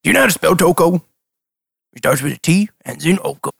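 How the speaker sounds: lightly voiced dim fisherman; a hint of a cheerful simple NPC; Toko voice.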